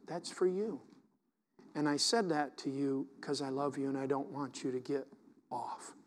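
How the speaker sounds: very soft imitation of God's private word; subtle, gentle. God correcting His child out of love, subtle and gentle. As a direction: keep it low and tender, fading away at the end. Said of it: "He just says it in a subtle way".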